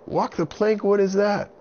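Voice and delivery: put-on very high, loud frightened boy; scared boy voice. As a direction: plead like a panicked little boy. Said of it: "And Avon said".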